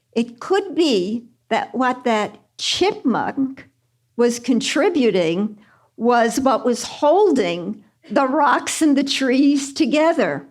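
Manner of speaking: normal talking voice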